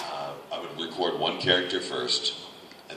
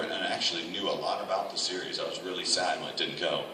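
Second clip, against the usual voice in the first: ordinary talk, nothing marked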